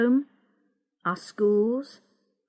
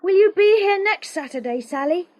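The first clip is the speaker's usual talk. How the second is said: raised, higher call across the rocks; 9 semitones higher, 5 dB louder than usual. Acted. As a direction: call back up to her. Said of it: "he looked back at me".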